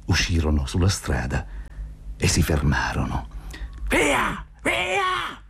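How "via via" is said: high-pitched, menacing shout; threatening cry. A narrator's reading. Zotov threatening the animals with a stick, high menacing shout. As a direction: drive them off with a high, sharp cry. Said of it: "li minacciò Zotov".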